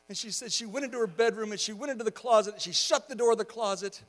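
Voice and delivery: ordinary delivery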